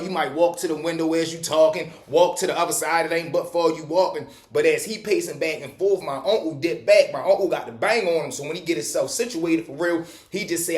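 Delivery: ordinary talk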